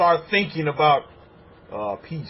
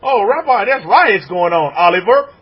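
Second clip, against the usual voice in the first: higher and louder, a mock cry of alarm; alarmed congregant; 4 semitones higher, 8 dB louder than usual. Congregant crying out about riots in France, higher and louder.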